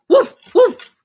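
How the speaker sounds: high, loud playful woofs; mock bark. Owner barking on the dog's behalf, high mock woofs. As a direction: bark for the dog.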